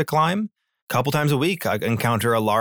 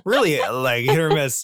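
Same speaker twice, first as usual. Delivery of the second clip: ordinary talk, nothing marked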